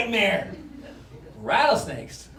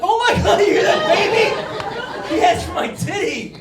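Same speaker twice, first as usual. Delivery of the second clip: much higher, louder shocked laughing shout; 12 semitones higher, 9 dB louder than usual. Retold or imitated. Parent calling to everyone about the baby, a loud shocked shout. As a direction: shriek it out in disbelief.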